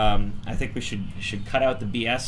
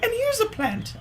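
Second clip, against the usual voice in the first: very high, fast chirpy mom voice; high mom impression. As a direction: squeak it out like a breezy mom. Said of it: "And she's like".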